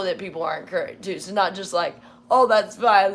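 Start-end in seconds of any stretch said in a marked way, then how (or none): 2.3-3.1 mock dismissive. Mocking people brushing it off.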